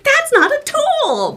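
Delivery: high, drawn-out protest with a laugh; exasperated mock wail; retold arguing. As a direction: whine back in mock outrage. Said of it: "that's why we started arguing".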